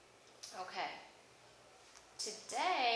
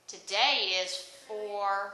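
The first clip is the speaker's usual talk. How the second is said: slow prompting; 5 dB louder, much slower than usual. Shown as a demonstration. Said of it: "teacher slows to prompt".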